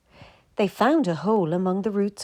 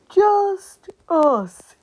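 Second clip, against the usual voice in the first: big loud yawn through the words; 13 semitones higher, 9 dB louder than usual. A narrator's reading. Sleepy gosling in the safe hole, yawning. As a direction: stretch it out in a yawn. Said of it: "yawned Gander Goosey".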